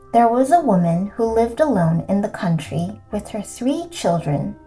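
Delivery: usual speaking voice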